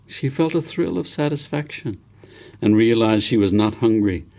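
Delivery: usual speaking voice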